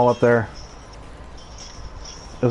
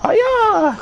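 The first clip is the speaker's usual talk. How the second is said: high piercing karate cry; high karate yell. Shown as a demonstration. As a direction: shriek it like a martial artist. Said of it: "Like that".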